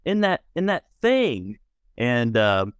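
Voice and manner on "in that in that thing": put-on high, wondering little-kid voice; curious little boy. Small boy asks his mom about the wheelchair, high and puzzled. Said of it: "the little boy continues, like".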